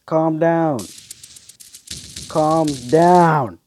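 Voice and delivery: loud, high "Calm down"; yelling. Real, not acted. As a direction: shout it at the attacker. Yelling at enemy mid-fight.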